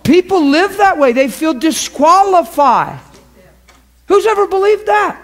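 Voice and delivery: high, loud impassioned preaching; preaching at full force. Real, not acted. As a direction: lift it and proclaim. Preaching peak.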